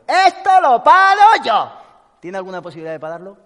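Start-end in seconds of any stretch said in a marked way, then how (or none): 0.1-1.7 high, loud and slow, a hammed-up bellow; shouted at the river, dragged out and silly; mock defiant shout. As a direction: yell it at the river like a fool who thinks he can stop it. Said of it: "se mete en el río y dice".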